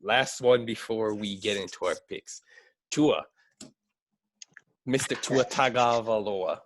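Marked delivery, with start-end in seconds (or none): none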